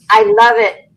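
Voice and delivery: ordinary talk, nothing marked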